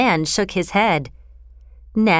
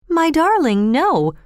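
higher-pitched, tender dismay; shocked reassurance; 7 semitones higher, slower than usual. A narrator's reading. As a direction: recoil from the idea and reassure a child. Mother shocked by daughter's question.

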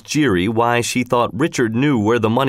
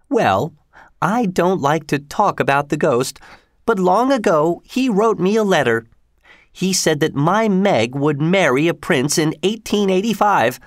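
higher woman's voice, earnestly confiding; Missus Giry; 6 semitones higher than usual. A narrator's reading. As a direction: play the believer explaining herself, pitched up.

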